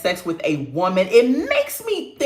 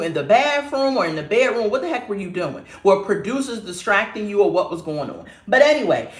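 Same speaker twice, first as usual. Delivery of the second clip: normal talking voice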